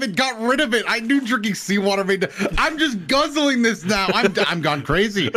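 loud, high panicked rant; wild gamer panic; frantic yelling. Real, not acted. Panicking after drinking seawater in game.

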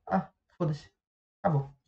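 soft, resigned dismissal; imitating a bored brush-off; dismissive sigh. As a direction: let it go with a shrug. Shrugging off the insult.

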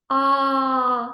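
"А" held long on one level note; isolated vowel. Shown as a demonstration. Teacher giving the answer sound, long steady "А". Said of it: "звук".

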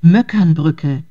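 usual speaking voice

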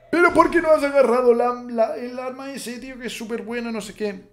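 high, whiny mock viewer complaint; mock indignant commenter. Mocking angry viewers in the comments, high whiny voice. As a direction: play an outraged fan moaning, squeaky and put-on. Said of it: "gente estará indignada en los comentarios diciendo".

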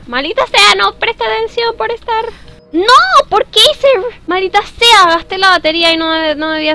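high, loud exasperated outburst; frustrada. Real, not acted. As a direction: curse your own mistake in a high wail. Lamenta gastar la batería. (queja aguda y exasperada)

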